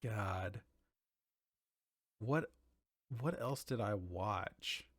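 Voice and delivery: ordinary delivery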